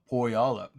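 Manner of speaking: "Puyallup" with an oh-like first vowel; grandpa's pronunciation. Retold or imitated. Teacher repeating grandpa's word, "Puyallup" with Southern oh-like start. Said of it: "is the way he said the word".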